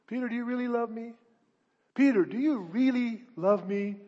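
Jesus asking. Acted. Jesus voiced questioning Peter.

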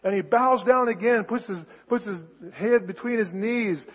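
normal talking voice

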